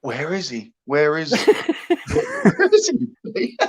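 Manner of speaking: repeated question through giggles; mock searching, laughing; laughing retelling. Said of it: "I was looking, going".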